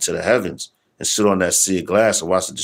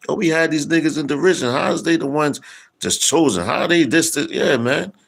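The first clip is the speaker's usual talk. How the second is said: mock scoffers; 8 semitones higher than usual. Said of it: "like the Wisdom of Solomon said".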